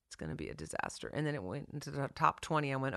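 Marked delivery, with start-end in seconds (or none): 0.1-1.1 much lower, softer and fast; gloomy mutter. Retold or imitated. Songwriter dreading the record's release, low quiet mutter. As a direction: mumble the doom to yourself. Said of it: "but I was just".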